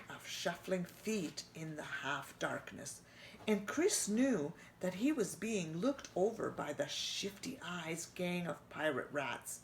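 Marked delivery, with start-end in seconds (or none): none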